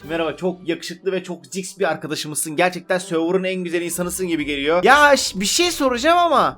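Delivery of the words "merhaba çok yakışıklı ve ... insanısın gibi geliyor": butter up the stranger gently; fawning flattery. Real, not acted. Buttering up a stranger player to get a pet, soft and fawning. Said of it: "yağcılık yapma".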